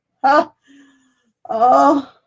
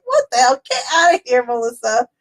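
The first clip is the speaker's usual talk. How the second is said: incredulous. Retold or imitated. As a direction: pitch up, mock outraged. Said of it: "I was like".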